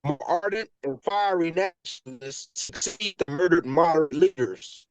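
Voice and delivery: distorted robotic phone signal. Real, not acted. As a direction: read through a glitchy robotic connection. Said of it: "you sound robotic".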